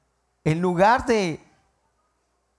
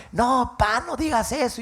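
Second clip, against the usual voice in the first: put-on pleading little boy; 9 semitones higher than usual. Boy pulls drunk father inside.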